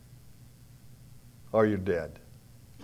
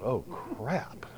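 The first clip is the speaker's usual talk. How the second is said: muttered dismay; 12 dB quieter than usual. Retold or imitated. Runner's private dismay.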